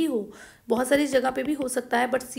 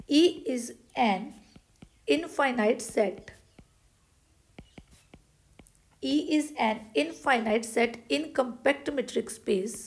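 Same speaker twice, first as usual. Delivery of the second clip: slow dictation; much slower than usual. Shown as a demonstration. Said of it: "dictating theorem slowly".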